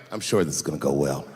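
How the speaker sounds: low, self-assured backstage voice; smug confidence. Retold or imitated. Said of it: "I'll be backstage like".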